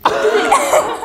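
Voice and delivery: real giggle breaking out, high; bursting into laughter. Real, not acted. Embarrassed about the ex.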